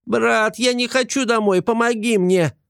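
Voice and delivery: high, squeaky plea; cling on and beg for help; begging gremlin. Acted. Gremlin pleading not to be sent home, high squeak.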